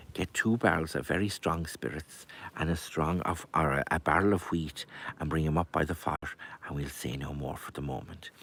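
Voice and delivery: low, hushed confiding voice; whispered instructions. A narrator's reading. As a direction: confide a secret plan softly into someone's ear. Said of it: "he whispered into her ear".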